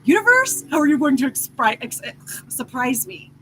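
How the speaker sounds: very high, loud, breathless gushing at the universe; over-the-top excitement. Retold or imitated. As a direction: squeal with giddy anticipation. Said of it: "or excitement".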